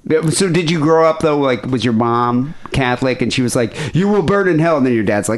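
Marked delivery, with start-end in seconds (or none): 4.0-4.8 lightly voiced, higher-pitched scolding mom; a hint of hellfire warning, imitated; put-on motherly threat, raised pitch; mock damning mother.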